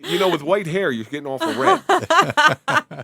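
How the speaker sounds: teasing through laughter; laughing. Real, not acted. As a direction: laugh while you tease.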